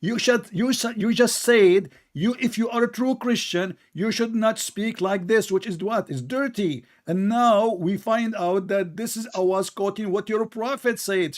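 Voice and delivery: high-pitched, insistent rebuke; indignant scolding. Real, not acted. Host quotes opponent's rebuke back at him, indignant. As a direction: press the accusation over interruptions. Heated accusation continues over interruptions.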